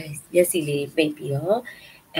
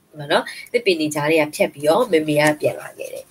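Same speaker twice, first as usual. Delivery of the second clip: ordinary delivery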